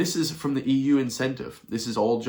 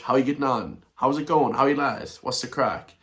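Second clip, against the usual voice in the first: bright, higher, chatty Irish hello; typical Irish greeting; 3 semitones higher than usual. Retold or imitated. To staring strangers on the beach, cheery Irish greeting. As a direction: greet the lads like a friendly Irishman. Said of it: "Typical Irish".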